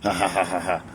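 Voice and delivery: low, soft mock chuckle; imitated grinning laugh. Mama looking at her hurt child, soft imitated laugh. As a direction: imitate mom's quiet ha-ha through a little grin. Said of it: "with a little grin".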